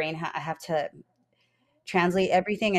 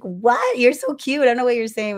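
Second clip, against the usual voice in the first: high, louder delighted reaction; charmed gushing; 6 semitones higher, 5 dB louder than usual. Retold or imitated. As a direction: gush in baffled delight. Retells gushing over Scottish accents.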